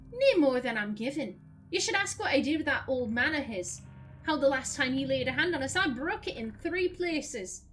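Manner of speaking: character line in broad northern English; broad Lancashire accent. Acted. Isilmir boasts she broke his hand, broad Lancashire accent. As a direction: perform it with a full Lancashire accent. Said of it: "I'm doing my natural accent".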